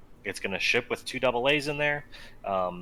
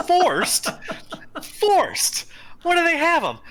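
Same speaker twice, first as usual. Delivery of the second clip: incredulous; 7 dB louder, much slower than usual. Real, not acted. Mock outrage at forced deal.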